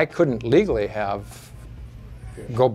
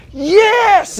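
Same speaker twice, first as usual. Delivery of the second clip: much higher, much louder triumphant yell; joyful scream; 13 dB louder than usual. Retold or imitated. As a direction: shout it out in victory. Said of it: "screaming in his house".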